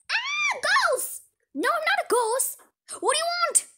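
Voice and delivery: high, loud shriek; scared scream. Acted. Girls and stranger screaming at each other, shrieking. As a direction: scream like you saw a ghost.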